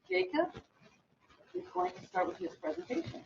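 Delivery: ordinary delivery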